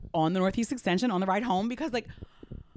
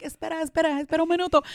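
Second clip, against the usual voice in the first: urgent; 8 semitones higher, 4 dB louder, faster than usual. Retold or imitated. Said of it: "I'm trying to get him to wait".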